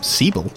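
Sable echoed as "Seeble"; imitated mispronunciation. Game master querying player's name, Sable as "Seeble". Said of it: "What do you call him?".

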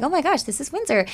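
high, thrilled gasp of recognition; excited amazement. Retold or imitated. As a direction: sound starstruck at your own town. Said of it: "And I was like".

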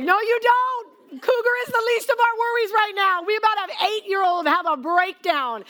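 high, exasperated wife; exasperated high scolding. Retold or imitated. Wife warning husband about the meltdown, high exasperated scolding. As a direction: scold him in a squeaky panic.

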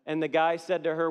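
normal talking voice

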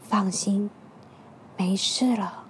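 soft, gentle whisper. Acted. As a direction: soothe them quietly. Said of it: "轻声细语地说".

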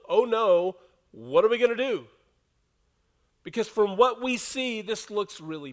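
loud, high panicked outcry; alarmed servant. Acted. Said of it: "The servant is responding in this crisis".

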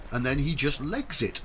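normal talking voice

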